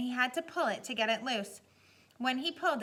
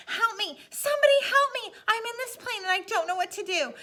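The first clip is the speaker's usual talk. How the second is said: much higher, loud desperate scream; panicked screaming; 11 semitones higher, 7 dB louder than usual. A narrator's reading. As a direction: scream for help, terrified. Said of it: "he began to scream into the microphone".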